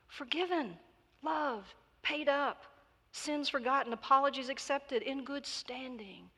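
high-pitched list of hopeful pleas; pleading. A narrator's reading. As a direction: implore item by item, pitch held high.

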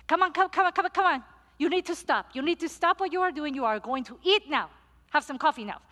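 high, loud and pushy; insistent loud calling. Retold or imitated. Luisa calls the pastor to eat, loud and insistent. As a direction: hurry them along to the table.

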